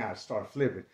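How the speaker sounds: normal talking voice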